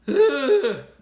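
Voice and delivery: big high, loud nervous sigh; loud anxious sigh. Retold or imitated. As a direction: let out a huge sigh of nerves. Anxious guy before confessing.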